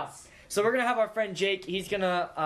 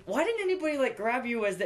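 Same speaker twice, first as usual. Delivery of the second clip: high-pitched, baffled question; incredulous thought; 6 semitones higher than usual. Retold or imitated. Co-host questioning friend's sledding story, incredulous. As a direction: quote your own disbelief, pitched up. Voices own puzzled thought.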